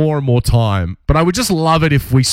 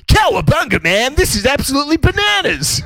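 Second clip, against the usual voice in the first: surfer turtle voice; 13 semitones higher than usual. Retold or imitated. Mocking the turtles' movie voice, high and shouted. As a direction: yell it like a hyped surfer turtle. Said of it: "they're like".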